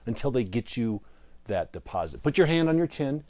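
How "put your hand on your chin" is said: usual speaking voice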